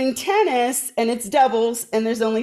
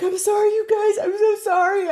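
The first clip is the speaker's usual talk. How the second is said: retold crying apology; 10 semitones higher than usual. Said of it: "I was crying".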